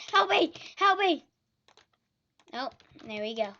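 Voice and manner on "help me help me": high loud cries for help; panicked shout. Real, not acted. Swamped by potions in game.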